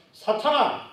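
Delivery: high sharp "사탄아" call; quoted rebuke of Satan; stern rebuke. Acted. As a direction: rebuke him like a master. Jesus rebukes Peter.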